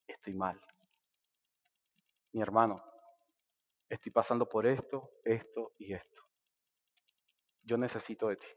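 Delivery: soft, low, honest confession; quiet inner prayer. Retold or imitated. Believer asking the Lord and his brother, softly. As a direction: murmur it as if praying to yourself.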